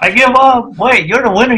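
high, wailing playful protest; hammed-up cry of giving up; mock surrender. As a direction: throw in the towel in a high whine. Other speaker acts imagined reaction.

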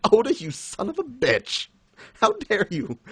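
high-pitched, playful indignation; hammed-up offended protest; mock outrage. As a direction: curse him out in fun. Mock outrage at author trolling.

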